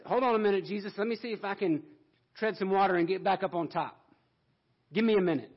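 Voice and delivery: hammed-up casual Peter pleading for a minute; Peter stalling. Peter stalling while sinking, mock.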